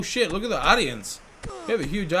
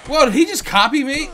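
surprised outburst; 9 semitones higher, 8 dB louder than usual. Real, not acted. Opponent mirrors his move.

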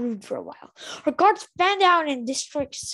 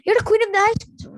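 lightly voiced, higher and louder, fast challenge; a hint of a defiant Luna; 7 semitones higher, 6 dB louder, faster than usual. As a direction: lift your chin and challenge her. Luna's voice, chin lifted.

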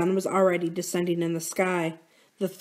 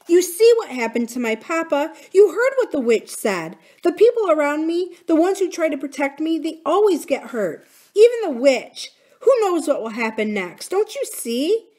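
high, louder, urgent appeal; distressed pleading; 8 semitones higher, 5 dB louder than usual. Acted. Corinne warns the brothers that protectors get hurt, pleading. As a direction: plead to make them understand.

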